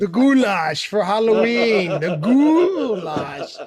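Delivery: drawn-out relish. Acted. Savoring the word goulash.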